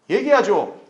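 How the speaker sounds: normal talking voice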